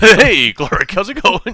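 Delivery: loud, high, over-friendly greeting; fake cheerful dad. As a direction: greet him with hammed-up cheer.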